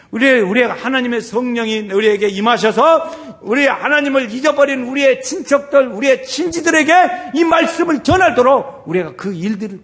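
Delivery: high, loud shouted exhortation; full-force preaching. Real, not acted. Preacher urges congregation to evangelize relatives, loud high preaching. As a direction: drive the charge home at full voice.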